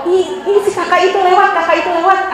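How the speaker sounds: high, loud mock squeal of words; giddy crush excitement. Teacher plays teen spotting a crush, giddy excitement.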